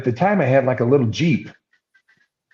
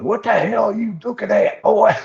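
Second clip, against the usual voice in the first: angry challenge; 10 semitones higher than usual. Retold or imitated. Old farmer to a kid peeking over his fence, angry holler. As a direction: holler it, catching a trespasser.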